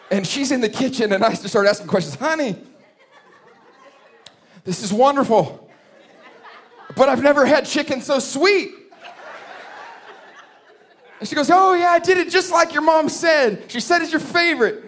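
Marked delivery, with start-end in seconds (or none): 2.1-8.7 high, laughing fake compliment; praising awful chicken through laughter; forced polite praise. Husband praising his wife's burnt chicken, high and laughing. 11.5-14.8 very high, loud, bright-eyed wife; cheerful proud wife voice, much higher; proud young wife. Retold or imitated. Wife tells husband she followed his mom, very high and loud.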